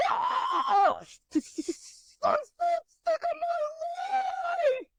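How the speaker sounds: very high, strained mock scream; acted breakdown scream. Woman reenacting her hair meltdown, screaming in mock despair. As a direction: drag it out into a high squealed wail.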